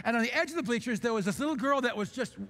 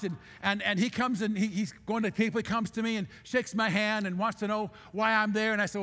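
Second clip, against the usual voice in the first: usual speaking voice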